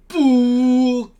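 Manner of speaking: long, loud imitated horn blow; drawn-out high horn hoot; loud horn blast. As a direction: blow a big trumpet note with the voice. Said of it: "크게 불었어요".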